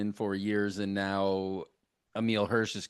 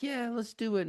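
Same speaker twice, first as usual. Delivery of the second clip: high mock boyfriend voice; 13 semitones higher, 4 dB quieter than usual. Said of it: "he's like".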